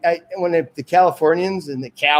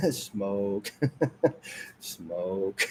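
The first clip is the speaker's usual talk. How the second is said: fading laughing whisper; 5 semitones lower, 12 dB quieter than usual. Shown as a demonstration. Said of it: "Watch this".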